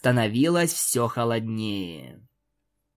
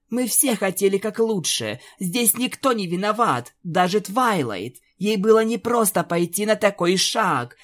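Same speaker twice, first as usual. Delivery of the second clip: higher, shaky consolation; trembling voice; 7 semitones higher than usual. Acted. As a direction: hold back tears while comforting. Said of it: "дрожащим голосом говорила Рейнбоу". (дрожащим голосом)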